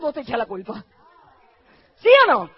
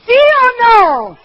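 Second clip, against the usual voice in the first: loud, slow, higher demand; loud challenge; 5 semitones higher, 11 dB louder, much slower than usual. Real, not acted. Pastor demanding agreement from the congregation, loud and slow. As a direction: call it out to the whole room.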